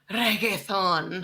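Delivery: "reggaeton" slowly in a showy Spanish accent; overdone Spanish pronunciation of "reggaeton". Shown as a demonstration. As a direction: say "reggaeton" with a rolled Spanish r, drawn out. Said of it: "I said a word in an accent".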